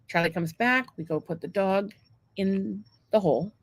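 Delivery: ordinary talk, nothing marked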